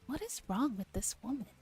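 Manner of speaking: ordinary delivery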